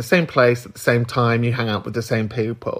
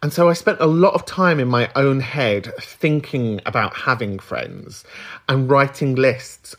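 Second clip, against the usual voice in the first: normal talking voice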